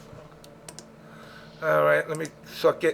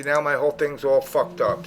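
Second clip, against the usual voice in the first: usual speaking voice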